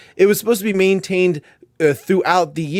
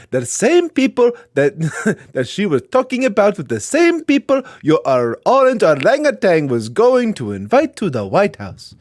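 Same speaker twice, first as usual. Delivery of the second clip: put-on reading in a light African accent; a hint of an African accent; 3 semitones higher than usual. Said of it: "we have to do an African accent".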